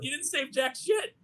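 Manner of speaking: high, slow, laughing jab; mock it slowly through a laugh; laughing scorn. Real, not acted. Mocking the film's line.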